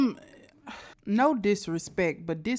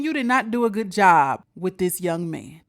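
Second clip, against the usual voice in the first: ordinary delivery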